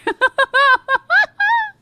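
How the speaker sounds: a burst of high, loud laughter. Real, not acted. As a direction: crack up laughing. Laughing at own joke.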